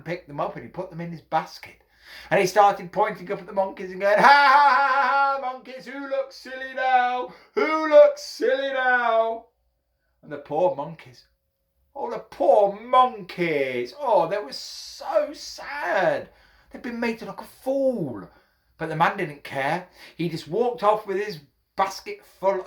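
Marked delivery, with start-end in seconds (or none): 4.2-9.5 high, loud singsong jeer; gloating taunt. A narrator's reading. Hatter pointing up at outwitted monkeys, gloating. 11.9-18.3 high, drawn-out sympathetic croon; mock pity. A narrator's reading. Storyteller pitying the fooled monkeys, mock-sad.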